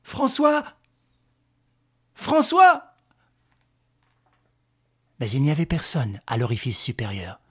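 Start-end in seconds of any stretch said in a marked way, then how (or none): 0.0-0.6 high, strained call upward; stifled call. Acted. Woman calling up to François from the abyss, in a stifled cry. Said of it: "appela d'une voix étouffée". 2.1-2.8 very high, louder second call; stifled cry. Acted. Woman calling François who does not answer, louder stifled cry. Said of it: "appela d'une voix étouffée".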